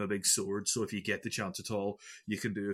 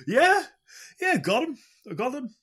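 much higher, louder, triumphant yelp; excited cheer; 12 semitones higher, 7 dB louder than usual. Real, not acted. Gamer celebrating a boss kill, excited cheer. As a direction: crow over the kill like you just won.

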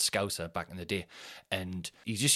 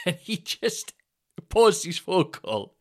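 usual speaking voice

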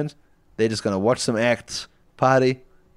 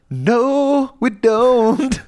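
character voice; 12 semitones higher, 4 dB louder, much slower than usual. Acted. Tony Hawk answers bouncer.

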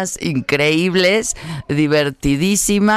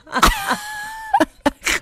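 big laugh. Real, not acted. Laughing at her own request.

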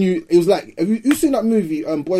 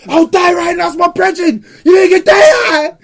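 high, loud frantic screaming; 11 semitones higher, 7 dB louder than usual. Retold or imitated. Friend chasing off the youths for his brethren, screaming. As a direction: scream it like your brother's down. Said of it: "Start screaming".

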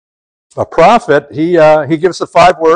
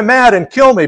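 Jonah's despair; 8 semitones higher than usual. Retold or imitated. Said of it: "Jonah's back to".

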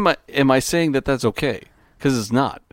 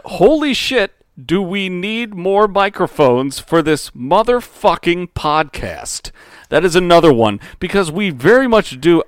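exasperated recitation; 5 semitones higher, 5 dB louder than usual. Acted.

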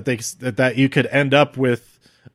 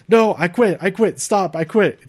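higher pleading imitation; Jey giving up; 6 semitones higher than usual. Imitating Jey quitting to save his brother, higher pleading voice. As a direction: mock-desperate surrender to save him.

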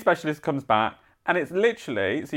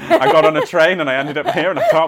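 laughing through it; 3 semitones higher, 8 dB louder than usual. Retold or imitated. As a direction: quote the joke while laughing. Laughing through a quoted joke.

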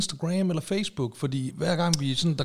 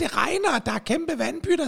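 put-on much higher little-kid voice; child voice; 13 semitones higher than usual. Shown as a demonstration. Co-host trying the kid's line again, high childlike. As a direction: pitch it up like a small child. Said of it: "du skal lave med nieren stemme".

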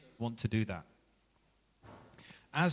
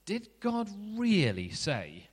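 a lightly voiced sly serpent, slow and higher; sly snake; 9 semitones higher, slower than usual. Snake questioning the woman in the garden, lightly voiced sly tempter. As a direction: tempt her with a drawn-out question.

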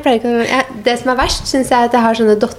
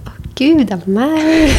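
higher startled "det er meg"; mock shocked; 6 semitones higher, slower than usual. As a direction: act startled at hearing yourself. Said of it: "så blir jeg sånn".

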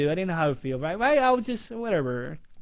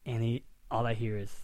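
usual speaking voice

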